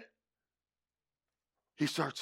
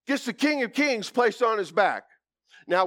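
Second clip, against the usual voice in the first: ordinary talk, nothing marked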